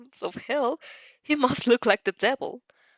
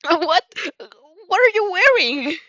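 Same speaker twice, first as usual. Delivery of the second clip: flustered shock; 8 semitones higher, 6 dB louder than usual. Acted. Izuku shocked by outfit.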